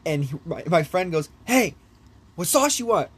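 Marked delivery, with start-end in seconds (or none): 1.4-3.1 raised, higher-pitched retold call; higher-pitched mock wake-up call; calling to a sleeper. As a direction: imitate a friend trying to rouse someone. Waking the sleeping friend.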